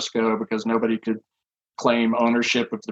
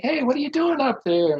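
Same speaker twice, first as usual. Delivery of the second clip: higher, friendly calling-up voice; negotiator calling up. Retold or imitated. Police negotiator calls up to the climber on the bridge, higher friendly voice. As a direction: play the polite negotiator calling up, pitched high.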